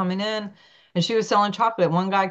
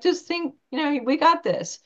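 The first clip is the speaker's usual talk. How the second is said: cheerful reassurance; 9 semitones higher than usual. Retold or imitated. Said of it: "I was just like".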